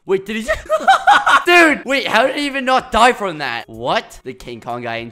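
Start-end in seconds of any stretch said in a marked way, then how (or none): 0.7-1.4 high, loud burst of laughter; sudden laugh. Real, not acted. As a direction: laugh out in disbelief. Player survived impossible fall.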